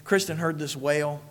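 ordinary delivery

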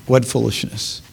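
hushed second take; quiet echo. Shown as a demonstration. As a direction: let it fall away, shaking the head. Preacher repeats line, softer echo.

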